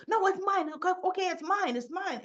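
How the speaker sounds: high, hurried admission; imitating a flustered kid owning up; frantic confession. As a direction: give in quickly in mock panic. Boy hastily confesses to save vape.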